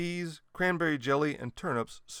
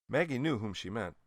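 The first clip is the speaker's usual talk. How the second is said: ordinary delivery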